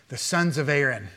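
ordinary delivery

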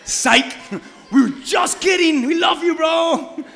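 high, loud, slow hammed-up shouting; mock crowd reversal. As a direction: oversell the change of heart. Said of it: "And they're like".

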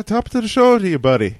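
high-pitched go at an Irish greeting; mock Irish, pitched well up; attempted Irish accent. Shown as a demonstration. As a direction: have a try at a better fake Irish accent. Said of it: "I could probably do better than that".

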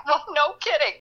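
high-pitched, tickled aside; thrilled retold thought. Fan thinking back at Cary Grant, thrilled and tickled. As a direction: squeal lightly at the obvious, then laugh.

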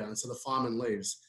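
ordinary talk

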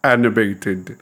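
slow, dramatic Count Dracula drawl; bad vampire voice. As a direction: ham it up like a campy vampire host. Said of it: "I'm totally finished with the vampire voice".

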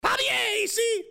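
high, broken mock-French shout; mock French accent. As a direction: ham up the bad French. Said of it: "Mon français, euh, pas bien".

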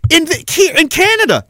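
high, startled question; surprised. Pretend. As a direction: sound caught off guard. Acted naive questioner.

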